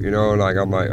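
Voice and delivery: ordinary talk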